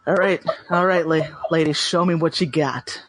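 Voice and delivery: low, lightly husky flirt; a hint of a sultry lady; dusky voice. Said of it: "I would say dusky".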